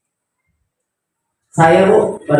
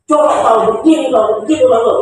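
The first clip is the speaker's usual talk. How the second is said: scolding parent; 6 semitones higher than usual. Retold or imitated. Parent scolding a hungry child home from school, mock shouting. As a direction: nag the kid sharply, half-acted.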